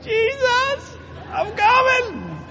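very high, drawn-out mock wail; panicked cry. Preacher re-enacts thinking he was dying, high wail. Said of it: "I panicked".

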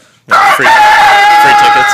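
high excited shout. Real, not acted. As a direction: yell it out high. Shouted reply.

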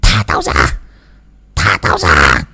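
the spirit barks to be let go, lightly voiced; possessed snarl. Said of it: "mà quát". (giọng vong nhập gằn giọng nhẹ)

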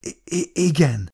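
stuttering, mumbled yes, barely there; dazed stammered mumble. A narrator's reading. Dazed captain stammers a reply to the woman, mumbling. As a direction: stumble over the first sound in a daze. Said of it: "motyogta Oram".

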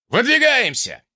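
high ringing call to move out; shouted command. Acted. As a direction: shout it to the whole squad. Chief orders the advance.

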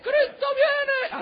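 very high, drawn-out comic crow; crowing rooster. Pretend. Rooster waking a runaway at five a.m., crowing voice. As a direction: crow the words like a rooster, stretching them out. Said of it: "que grita, que dice".

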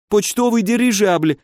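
high-pitched, pained exclamation; desperate explanation. A narrator's reading. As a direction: blurt it out in a high frightened voice. (испуганно, высоким голосом)

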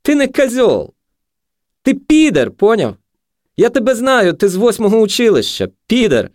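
very high, loud jeering; high-pitched, strident taunting; abusive junkie. A narrator's reading. Junkie hurls insults.

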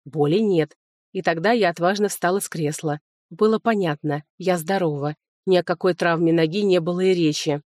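usual speaking voice

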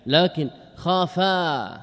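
خافا stretched slowly with its long alif kept; slow down on خافا to show the alif stays; drawn-out word. Shown as a demonstration. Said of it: "تبقى الألف أو لا؟". (خافا ممدودة ببطء مع إبقاء الألف)

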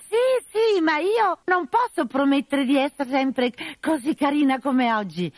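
high, coy falsetto of a man posing as a lady; disguised bride voice. Acted. Arlecchino in disguise, flirting with the captain, high and coy. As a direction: play the fake bride, coy and sweet.

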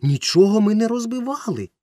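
high loud indignant denial; boy shouting his innocence; exclaiming. A narrator's reading. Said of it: "вигукнув Ромка".

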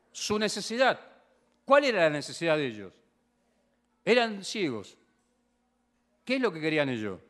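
loud, high preaching to the congregation; forceful preaching. Acted. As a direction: drive the point home like a preacher.

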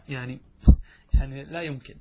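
ordinary talk, nothing marked

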